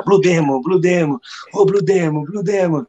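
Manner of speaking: high-pitched mock shouting of fans; imitated crowd calls. Crowd suddenly spotting Blue Demon around the car, imitated high calling out. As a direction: call out like an excited crowd, voice pitched well up.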